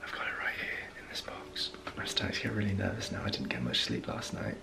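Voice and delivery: whispering so Monica upstairs won't hear. Real, not acted. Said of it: "I've gotta be really quiet".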